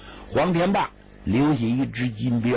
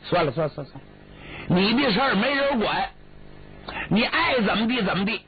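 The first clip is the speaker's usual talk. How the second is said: exasperated brother; 5 semitones higher, faster than usual. Acted. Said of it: "最后李俊说话了".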